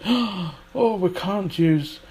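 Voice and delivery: gasp, then a high, scandalised "oh"; mock shock. Teacher imitating shocked teachers of British English, mock horror.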